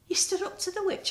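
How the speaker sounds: high, fast amazed question; astonished by the news; amazed exclaim. A narrator's reading. Marcia to Spider, high and amazed. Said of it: "exclaimed Marcia".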